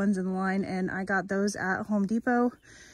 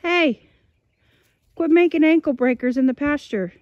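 calling out; 4 semitones higher, 7 dB louder than usual. Real, not acted. Calling out to dog across pasture.

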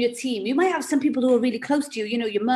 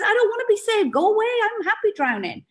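stubborn refuser; 9 semitones higher, faster than usual. Pretend. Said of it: "the person who says".

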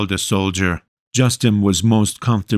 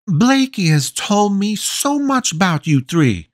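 cheerful mother; 12 semitones higher than usual. A narrator's reading. Voices the mother Gretchen.

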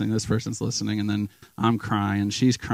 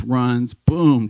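normal talking voice

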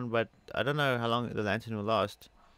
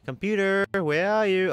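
loud, high call for the computer; raised voice searching for someone; 8 semitones higher, 5 dB louder than usual. Real, not acted. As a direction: shout across the room for it. Calling out for the computer.

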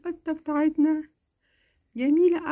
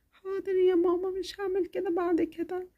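put-on meek little girl; 2 semitones higher, 4 dB quieter than usual. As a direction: say okay like a told-off kid. Child promises.

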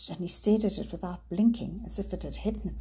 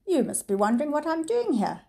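high, louder, falsely pleasant villain; a smooth villain feigning charm; 8 semitones higher, 5 dB louder than usual. A narrator's reading. Villain greets the children with fake pleasantness. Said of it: "Mister Gamal said pleasantly".

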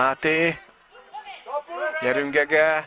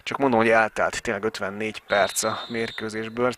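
ordinary delivery

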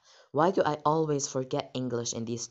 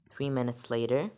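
usual speaking voice

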